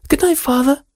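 put-on high sweet girlish voice; cartoon princess. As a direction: sing it up sweetly. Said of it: "said the princess".

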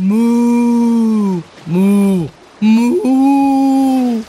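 long, drawn-out cow moo; steady cow moo. Shown as a demonstration. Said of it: "you have to moo three times".

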